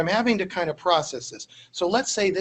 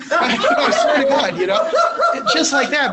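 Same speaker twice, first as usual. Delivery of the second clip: laughing; 9 semitones higher, 7 dB louder than usual. Real, not acted. Laughing at the cabbie's surprise.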